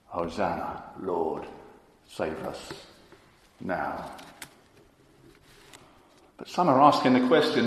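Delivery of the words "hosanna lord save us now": slow, low, soft plea; hushed cry. Retold or imitated. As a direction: let the crowd's cry come out hushed. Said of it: "they cried out".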